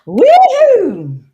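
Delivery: loud, high whoop; excited cheer. Pretend. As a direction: whoop like a game show host. TV host big opening cheer.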